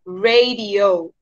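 drawn-out "radio", ray-dee-oh; English radio. Shown as a demonstration. Teacher repeating the model, radio slowly as ray-dee-oh. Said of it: "En inglés se dice radio".

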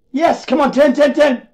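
high, excited rooting for a card; tense, hopeful cheering; eager urging. Real, not acted.

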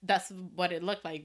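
normal talking voice

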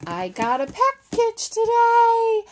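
high, bright announcement of a package; thrilled, raising the voice up high; real excitement over the delivery; excited. Real, not acted. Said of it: "I'm so excited".

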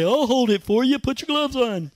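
lightly voiced child offering help; a hint of an eager kid holding the fire; kid's voice. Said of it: "one of the kids would say".

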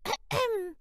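high squeaky mouse ahem; a small polite mouse clearing its throat; tiny throat clear. Acted. Mouse politely waking the lion, squeaky throat clear. Mouse getting lion's attention.

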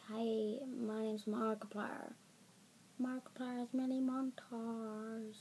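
soft, slow YouTuber intro attempt; Markiplier. Kid guessing at a YouTuber's intro, tentative impression. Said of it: "What is Markiplier's intro".

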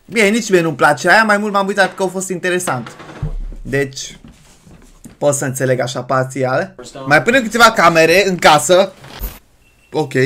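ordinary delivery

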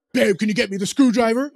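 loud, abrupt request to a wife; brusque demand. Shown as a demonstration. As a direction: fire off the request with no sweetness. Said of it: "It's all about tone".

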